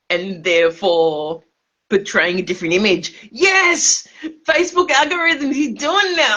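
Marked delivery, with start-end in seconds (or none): 3.3-6.4 much higher, loud exclamation; exultant gotcha; triumphant. Real, not acted. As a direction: cheer like you cracked the code. Drunk triumph over algorithm.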